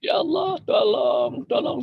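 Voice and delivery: high, tearful shout of tolong; trapped driver shouting for help in the dark, tearful and high; crying for help. Retold or imitated. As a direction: cry out for help, voice breaking. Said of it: "bisa minta tolong".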